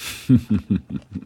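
a brief wry laugh before answering; short chuckle. Acted. Professor asked about the madness, short wry chuckle. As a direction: chuckle at the size of the question.

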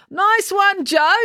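high-pitched cheer of praise; keen, high congratulation; enthusiastic. A narrator's reading. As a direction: call it out high, full of enthusiasm. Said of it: "said April enthusiastically".

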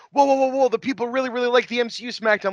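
high, excited, fast rush of words; very enthusiastic. Retold or imitated. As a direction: gush it out, all worked up. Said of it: "very, very enthusiastic, um, Ulrich going".

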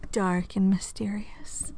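slow breathy mystery whisper; hushed mysterious storyteller voice; dark and mysterious. Retold or imitated. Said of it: "She was very".

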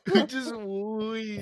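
high, slow and drawn out; high slow mock question. Real, not acted. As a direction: stretch it out in a squeaky tease. Repeating the teasing question.

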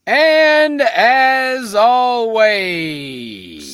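loud, slow ringmaster opening; ringmaster sign-off. Acted. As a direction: stretch it out like a showman. Stretched sign-off.

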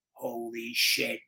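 slow, low, mechanical mock electrolarynx. Nephew imitating nervous uncle before the press conference, slow mechanical buzz. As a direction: drag it out through a throat buzzer, lightly imitated. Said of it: "He had the cancer kazoo".

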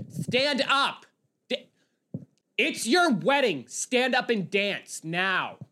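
high, drawn-out mock scream. Guest ordering the bridesmaid onto the dance floor, mock screaming. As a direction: mimic an insistent screamer, slow and high. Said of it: "they were just screaming at this bridesmaid".

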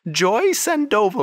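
high, bright-voiced lady shopkeeper. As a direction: gush over their outfits in a high lady voice. Shop lady repeats her name.

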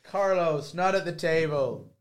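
high, loud, slow telling-off; mock scolding. Telling off her co-host for the feet rub, in a mock parental telling-off.

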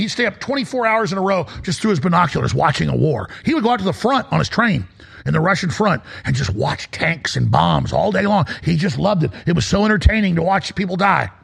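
usual speaking voice

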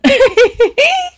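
high, loud burst of laughter. Real, not acted. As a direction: shriek of laughter. Laughs at seductive wave.

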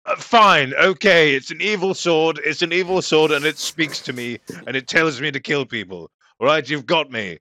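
loud, high, fed-up confession; exasperated outburst. Acted. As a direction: blurt it out in acted exasperation. Acted outburst admitting the evil sword.

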